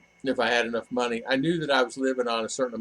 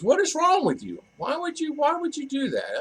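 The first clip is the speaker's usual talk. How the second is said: higher-pitched incredulous dad voice; imitating dad. Father asking son why he'd leave, incredulous. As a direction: mimic his father's disbelief, pitched up. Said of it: "And he says".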